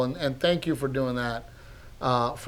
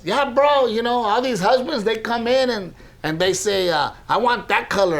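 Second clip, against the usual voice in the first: lightly voiced chatty bro, pitched up; Pollito; 9 semitones higher, 4 dB louder than usual. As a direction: imitate a joker telling his bit, higher voice. Acting out Pollito telling his bit.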